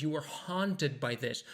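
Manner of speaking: "haunted" said slowly with a clear T; emphasized T. Shown as a demonstration. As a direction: stress "haunted", sounding the T after the N. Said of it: "you want to emphasize, uh, this letter".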